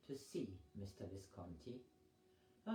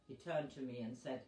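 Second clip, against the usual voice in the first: ordinary delivery, nothing marked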